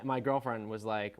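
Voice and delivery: ordinary talk, nothing marked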